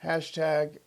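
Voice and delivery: ordinary talk, nothing marked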